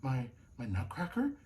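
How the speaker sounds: soft, halting astonishment; quiet, amazed question; gasped. A narrator's reading. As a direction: stammer it in hushed surprise. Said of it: "gasped Clara".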